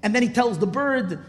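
ordinary delivery

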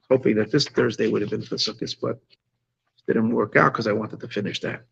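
sleepy, drowsy and low; drifting off to sleep; nodding off. Real, not acted. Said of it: "my eyes are closing".

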